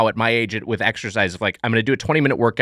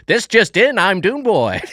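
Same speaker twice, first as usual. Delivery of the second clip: newsreel announcer; 6 semitones higher, slower than usual. Acted. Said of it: "You're a newsreel guy".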